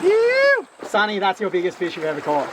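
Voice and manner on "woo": loud high cheer; excited whoop. Real, not acted. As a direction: let out a joyful whoop. Celebrating biggest fish.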